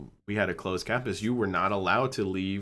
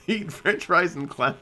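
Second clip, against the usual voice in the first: laughing; 8 semitones higher than usual. Real, not acted. Laughs recalling eating fries in class.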